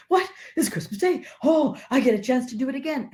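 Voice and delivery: high, quick gasp of joyful surprise; can't believe his luck; amazed delight. Acted. Scrooge's thrilled disbelief.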